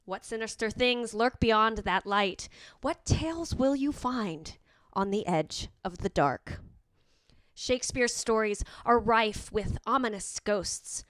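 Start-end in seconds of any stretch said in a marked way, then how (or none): none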